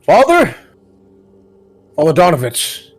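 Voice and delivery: loud shout for the priest; yelling. Acted. Ismark shouting into the church for the priest, loud call. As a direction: call out for Father Donovich at full voice. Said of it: "And he yells".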